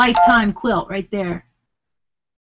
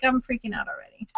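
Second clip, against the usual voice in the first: nervous whisper under the breath; whispering in real nerves; whispered freak-out; 8 dB quieter than usual. Real, not acted. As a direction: hush it, tense and scared.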